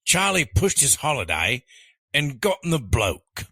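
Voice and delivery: lifted, hammed-up Australian accent. Rereading the last quiz choice on request, Australian accent. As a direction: lean into a playful Australian accent. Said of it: "read these choices in your best Australian accent".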